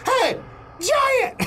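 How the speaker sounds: calling out. Acted. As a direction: shout up at the giant, taunting. David calling out to Goliath.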